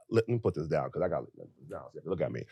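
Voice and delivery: low, soft, quick self-talk; muttered aside. Real, not acted. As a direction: drop out of the rant, hurried and low. Aside while putting something down.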